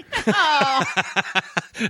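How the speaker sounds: a high, cackling burst of laughter. Real, not acted. Laughing at his own joke about post dog water, a high cackle. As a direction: crack up at your own joke.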